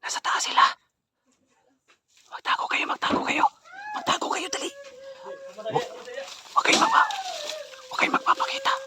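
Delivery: urgent high whisper; whispering while hiding. Real, not acted. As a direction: hiss it to the others, hiding. Hiding from two men.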